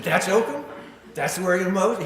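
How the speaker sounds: high, loud incredulous retort; astonished question. Retold or imitated. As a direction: retell it as disbelief, pitch shooting up. Retelling his startled reply to companion.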